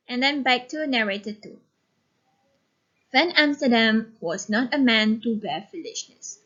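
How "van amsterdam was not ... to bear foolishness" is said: ordinary talk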